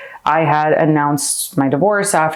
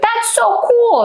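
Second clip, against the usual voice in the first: much higher, slow, wide-eyed awe; excited kids; slower than usual. Retold or imitated. Nieces and nephews hearing she's on set, amazed. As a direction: marvel at it like a little kid. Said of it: "they're like".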